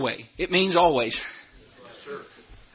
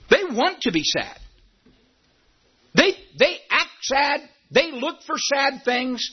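higher, shouted, exasperated preaching; 5 semitones higher than usual. Acted. Pastor scolding people who want to be sad, shouted preaching.

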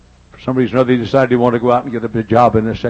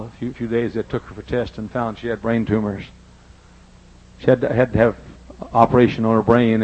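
usual speaking voice

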